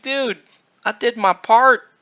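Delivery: high-pitched mock protest; retold protest. Speaker answers the yelling uncle, high mock protest. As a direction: plead your case, pitched well up.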